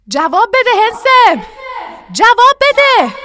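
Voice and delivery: high, loud call down a well; shouting. A narrator's reading. Father calls down the well to Kaka, shouting. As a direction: call out urgently, stretching the last words. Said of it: "دوباره فریاد زد".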